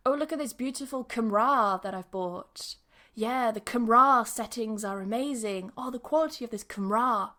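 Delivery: every camera said as kuh-MRAH; chatty praise with camera stressed wrongly on a long final RAH; mispronounced camera. Shown as a demonstration. Teacher acting out praise of a new purchase, camera mispronounced kuh-MRAH. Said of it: "It's not camera".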